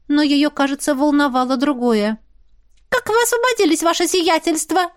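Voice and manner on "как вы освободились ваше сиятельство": high-pitched, sharp demand. A narrator's reading. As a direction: question her sharply in a high voice. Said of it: "резким тоном спросила она".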